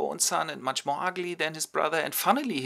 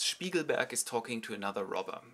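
normal talking voice

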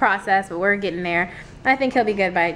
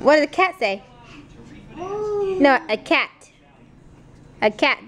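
ordinary delivery

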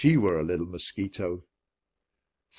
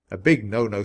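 ordinary delivery, nothing marked

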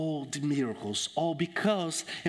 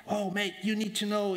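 higher-pitched, lightly Cockney chat; Cockney accent; 6 semitones higher than usual. Acted. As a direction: play a South London Cockney mate. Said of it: "with the Cockney accent".

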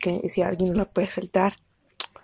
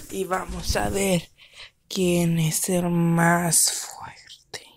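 soft, breathy contest host; whispered announcer; 6 dB quieter, much slower than usual. Acted. Host whispers the contest to the audience. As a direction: whisper it like a secret show.

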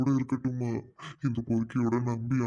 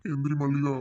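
crying; 2 semitones higher than usual. Acted. Tearful parting of daughter and father.

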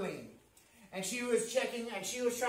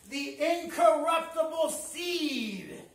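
"incorruptible seed" raised high and drawn out; emphatic proclamation; 4 semitones higher, slower than usual. Real, not acted. Preacher hammers key phrase.

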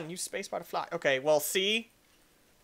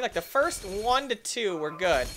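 normal talking voice

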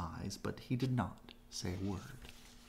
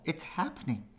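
excited rabbit; 7 semitones higher than usual. A narrator's reading.

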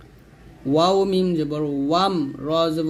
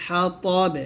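very slow, long hummed m and held vowels; Quran recitation; 3 semitones higher, much slower than usual. Shown as a demonstration. Teacher models stopping on the word, slow recitation. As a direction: stretch the verse, bounce the final b. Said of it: "যদি এখানে থামেন তাহলে বলতে হবে".

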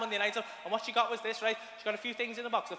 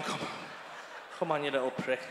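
psyching himself up; 7 semitones lower than usual. Retold or imitated. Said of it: "just going".

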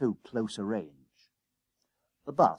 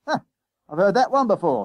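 loud, high dismissal; scoffing bus conductor; 9 semitones higher, 7 dB louder, faster than usual. Acted. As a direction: scoff at a passenger's old excuse. Conductor scoffs at boy's excuse.

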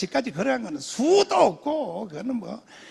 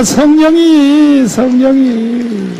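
drawn-out preaching; 10 dB louder, much slower than usual. Real, not acted. Preacher drawing out the word.

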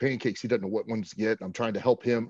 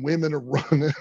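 ordinary talk, nothing marked

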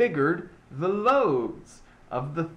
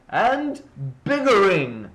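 higher, much louder yell; furious shout; 3 semitones higher, 7 dB louder than usual. A narrator's reading. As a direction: explode on the last biggering. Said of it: "I yelled at the Lorax".

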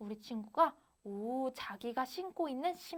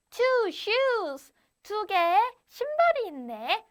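put-on story girl's voice; 9 semitones higher, 6 dB louder, slower than usual. Story girl discovers two shoes.

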